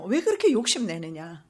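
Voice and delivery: a father scolding his greedy son; rebuking father. Acted. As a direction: scold him like a stern father. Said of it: "이렇게 책망하잖아요".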